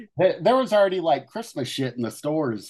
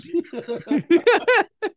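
high laugh; 3 dB louder than usual. Real, not acted. Laughing at own joke.

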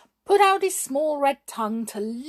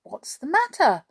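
story character's question; 7 semitones higher, 4 dB louder than usual. A narrator's reading. Kindly figure asks unhappy dog.

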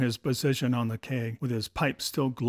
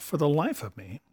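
ordinary delivery

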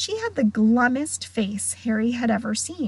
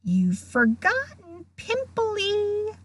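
high, slow, needling tease; taunting hiss; 11 semitones higher, slower than usual. A narrator's reading. Poltergeist adding an insult in ghost's ear, hissing. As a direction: draw it out spitefully in her ear. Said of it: "Peeves hissed in her ear".

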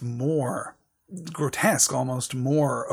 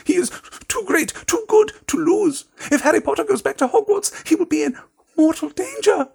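very high, louder squeak of a frantic elf; squeaky house elf; 4 dB louder than usual. A narrator's reading. Dobby begs Harry Potter to stay home, high frantic squeak. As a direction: squeak it out like a panicked little elf.

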